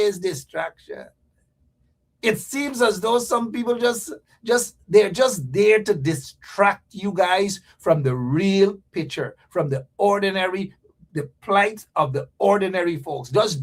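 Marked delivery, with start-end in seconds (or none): none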